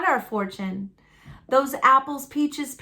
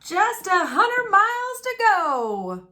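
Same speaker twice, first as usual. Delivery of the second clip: higher, slow, proud declaration; Daddy declaring; 7 semitones higher than usual. A narrator's reading. Father announcing the distance to his family, slow and proud. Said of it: "declared Daddy".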